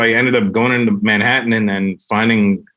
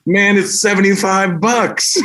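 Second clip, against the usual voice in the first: mock pleading; 10 semitones higher, slower than usual. Pleading reply quoted.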